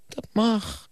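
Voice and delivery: lightly acted sorrowful outcry; sad exclamation. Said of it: "riep de juf verdrietig uit". (een vleugje verdrietige uitroep)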